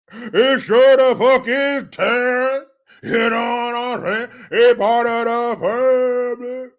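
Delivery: loud, high, slowly stretched politician mimicry; Barry Obama. Host redoes his reply as pandering politician, hammed-up impression. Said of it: "you have to talk like this".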